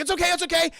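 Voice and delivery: high, loud imitated reassurance; flustered reassurance. Crewmate answers after the creature scare, mock flustered reassurance. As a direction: act out someone hurriedly insisting all is fine.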